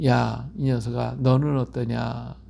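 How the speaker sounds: slow, low, drawn-out words; God gently addressing someone. Acted. God answers the one who cried out, slow and gentle. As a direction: speak slowly as God to his child. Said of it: "우리 하나님이 그러실 거야".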